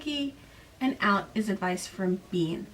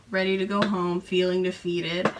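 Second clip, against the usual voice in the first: ordinary talk, nothing marked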